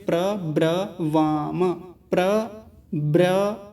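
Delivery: slow, separated pra, bra, va-ma; careful syllable breakdown. Shown as a demonstration. Teacher helping new readers with a hard word, slow syllable-by-syllable. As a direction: take it slowly, piece by piece. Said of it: "तो बड़े ध्यान से समझेंगे".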